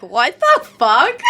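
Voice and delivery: slow, drawn-out mock bafflement; baffled disbelief. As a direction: stretch it out, utterly baffled. Voicing baffled high school friends.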